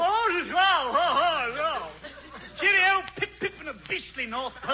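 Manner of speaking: high, loud British Santa; hearty ho-ho Santa with English slang; English Santa Claus. Acted. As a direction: put on a jolly English accent. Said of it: "English Santa Claus".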